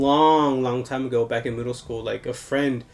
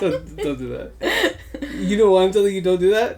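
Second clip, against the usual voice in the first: playful scolding; 6 semitones higher, 4 dB louder than usual. Real, not acted. Scolding cohost for the prank.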